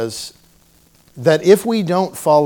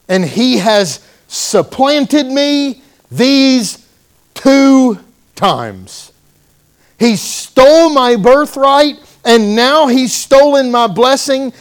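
aggrieved outcry; 10 semitones higher, 7 dB louder, slower than usual. Retold or imitated. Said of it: "And Esau said".

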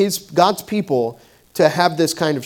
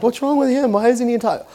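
higher, mildly puzzled teacher voice; 8 semitones higher than usual. Retold or imitated. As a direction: play a baffled teacher asking a colleague. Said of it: "the other teacher's like".

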